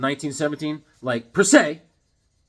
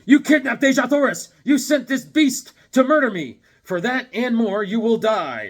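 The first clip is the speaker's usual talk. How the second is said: loud, higher, declaiming threat; dramatic hero voice; 7 semitones higher, 5 dB louder than usual. Acted. Reading dramatic cover blurb.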